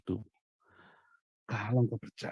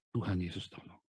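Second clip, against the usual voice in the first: quiet prayer; 3 semitones lower, 8 dB quieter than usual. Shown as a demonstration. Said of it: "langsung doa".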